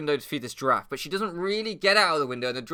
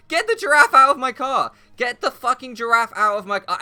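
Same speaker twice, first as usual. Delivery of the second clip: much higher and louder, frantic shouting; panicked yell; 10 semitones higher, 11 dB louder than usual. Retold or imitated. Yelling at the giraffe in his car, panicked shout. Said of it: "and I'm like".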